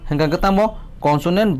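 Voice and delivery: usual speaking voice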